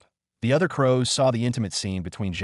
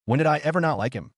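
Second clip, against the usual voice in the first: quick, slightly raised, rushing to deny; hurried; 5 semitones higher, faster than usual. A narrator's reading. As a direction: blurt it out fast in protest. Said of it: "Lai Wu hurriedly said".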